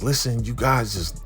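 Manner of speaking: upset protest. Retold or imitated. Bumpy confronting the FBI.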